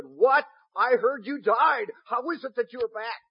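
high, loud cry of disbelief; astonished Nero. Acted. Nero to his cupbearer back from death, astonished.

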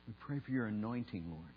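soft, reverent public prayer. Real, not acted. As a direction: pray quietly, pausing between phrases. Leads a prayer.

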